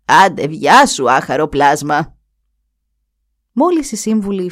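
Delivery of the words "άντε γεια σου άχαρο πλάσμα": calling out. Acted. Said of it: "της φώναξε".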